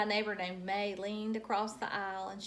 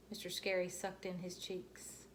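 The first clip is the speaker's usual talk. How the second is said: usual speaking voice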